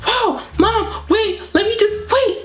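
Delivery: high, urgent plea to wait; acted flustered interruption. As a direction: re-enact trying to stop a panicking mother. Cutting off panicked mother.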